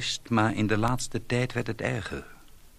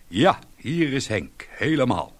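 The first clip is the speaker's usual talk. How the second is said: hearty phone call; 5 semitones higher, 6 dB louder than usual. Acted. Tipsy husband phones home.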